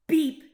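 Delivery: loud cheery horn beep; laughing beep. A narrator's reading. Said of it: "laughed Blue".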